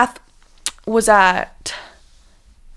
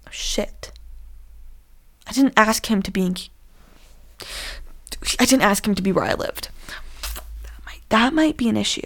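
whispered realization of a mistake; worried whisper; 6 dB quieter than usual. Real, not acted. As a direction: whisper it like a secret slip-up.

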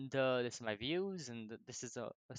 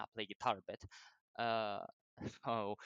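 ordinary talk

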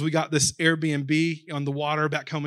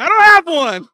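much higher, loud put-on voice; sheepish reply; 12 semitones higher, 16 dB louder than usual. Husband admitting he forgot the gift, high and loud. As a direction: squeak out the excuse like a caught husband.

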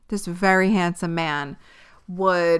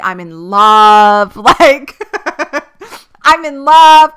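louder and louder, giddy, breaking into laughs; giddy in love; 6 semitones higher, 12 dB louder, slower than usual. Retold or imitated. Recalling falling for the new guy, giddy and laughing. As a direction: gush it, laughing with joy. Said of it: "And I'm giddy as fuck".

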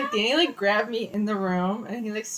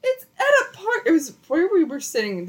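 high, raised-voice defense; indignant protest; 8 semitones higher, 4 dB louder than usual. Real, not acted. Defending the park make-out to friend, indignant protest. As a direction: argue back in a high, insistent voice.

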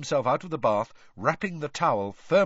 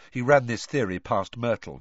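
ordinary delivery

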